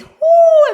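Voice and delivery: high, loud whoop of effort; excited whoop. Real, not acted. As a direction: let out a big straining whoop. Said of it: "I'm starting to feel my abs burning".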